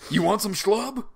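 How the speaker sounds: high, loud mock taunt; lightly hammed-up macho challenge; mock tough guy. Mocking the Schwimmer character.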